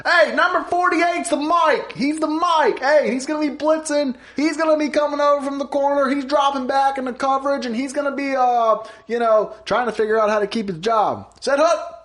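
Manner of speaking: high, loud quarterback barking calls at the line; Peyton Manning. Retold or imitated. Teammate mimics him reading defenses, loud shouted impression. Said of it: "he's reading defenses like".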